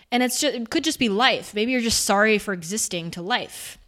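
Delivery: normal talking voice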